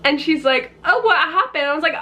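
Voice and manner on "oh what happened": high-pitched imitation of a worried mother; retold motherly alarm, high voice; concerned mom. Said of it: "there's this mom".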